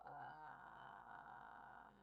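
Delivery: creaky unvoiced fry onset; vocal fry. Shown as a demonstration. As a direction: croak it softly in fry. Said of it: "You just make the vocal fry sound".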